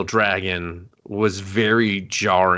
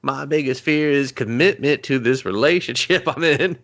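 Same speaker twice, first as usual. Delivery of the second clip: mock heroine speech; 5 semitones higher than usual. [name] mocked telling off bear.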